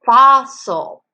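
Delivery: "fossil" slowly in two clear beats; split syllables. Shown as a demonstration. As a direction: break "fossil" in two for children to hear. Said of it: "Do you hear the syllables?".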